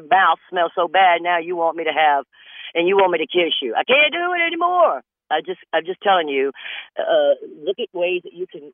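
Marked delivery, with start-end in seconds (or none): 3.7-5.0 high whine. Acted. Answer to wife.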